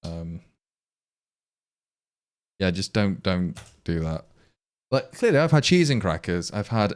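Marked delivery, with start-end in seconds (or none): none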